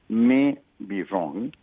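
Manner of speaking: "may" stretched long and set apart from "be wrong"; underlined MAY. Real, not acted. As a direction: repeat it very slowly, leaning hard on "may". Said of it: "Let me underline".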